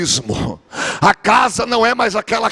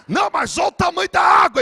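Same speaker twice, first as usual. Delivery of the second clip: Pedro yelling in terror at the waves; panicked shout; 11 semitones higher than usual. Acted. Pedro shouts to Jesus, panicked, about the huge waves.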